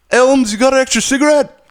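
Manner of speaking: high, loud put-on tough-guy voice; rough neighbor voice. Neighbor asking the narrator for a cigarette, loud mook voice.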